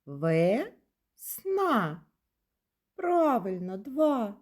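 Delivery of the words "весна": "Весна" slowly as Ве... сна, each syllable held apart; split into syllables. Shown as a demonstration. Teacher has children count syllables in "Весна", split slowly. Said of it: "Слухайте".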